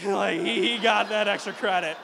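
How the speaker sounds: loud, laughing repeat. Real, not acted. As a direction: laugh through the line. Laughing repeat of friend's boast.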